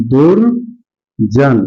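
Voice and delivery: दुर्जन drawn out very slowly; slow sounded-out word. Shown as a demonstration. Teacher answers his own question, sounding out दुर्जन slowly. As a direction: stretch दुर्जन out for the class. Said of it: "क्या बन जाएगा?".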